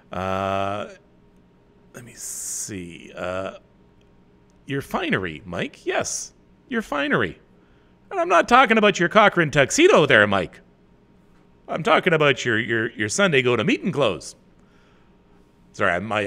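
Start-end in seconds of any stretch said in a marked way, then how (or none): 5.8-14.4 put-on much higher and louder folksy old-farmer voice; Charlie Farquharson. Host teasing Mike about his finery as a loud old farmer. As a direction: bellow it like a hayseed uncle. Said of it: "I'm channeling my Charlie Farquharson".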